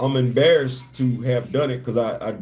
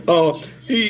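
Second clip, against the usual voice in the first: ordinary talk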